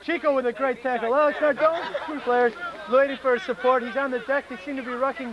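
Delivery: lively sports commentator voice; play-by-play commentary. Pretend. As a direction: call the match like live TV. Mock play-by-play.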